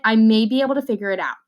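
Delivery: usual speaking voice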